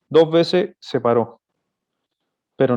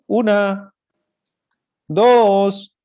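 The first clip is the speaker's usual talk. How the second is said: higher, imitating a parent counting down; 6 semitones higher, 4 dB louder, much slower than usual. Preacher imitates parents counting at a misbehaving child, higher. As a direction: count like a warning parent, raised pitch. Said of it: "Hay padres que cuentan".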